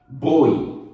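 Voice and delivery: "Boi" with a closed ô before the glide; closed diphthong. Shown as a demonstration. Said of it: "som fechado". ("Boi" com som fechado, ôi)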